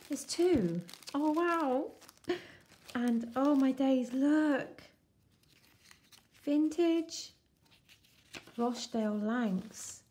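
high, excited squeals of delight; thrilled. Real, not acted. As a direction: gush with real excitement over the find. Thrilled unboxing vintage papers.

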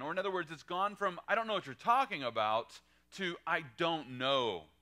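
high, loud preacher's build; fervent sermon delivery; preaching at full force. Acted. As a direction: drive it home to the congregation.

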